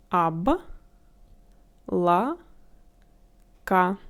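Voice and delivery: облака as Об, ла, ка with long pauses; syllable by syllable. Shown as a demonstration. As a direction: close the first syllable on б, then ла, then ка. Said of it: "add first consonant to the previous syllable".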